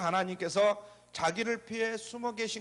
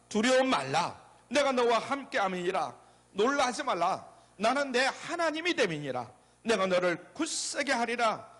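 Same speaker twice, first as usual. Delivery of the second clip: emphatic, lifted congregational reading; scripture recitation; 4 semitones higher than usual. Acted. Preacher leading worshippers in Isaiah, emphatic recitation. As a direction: proclaim each clause for all to follow.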